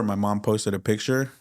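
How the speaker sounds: usual speaking voice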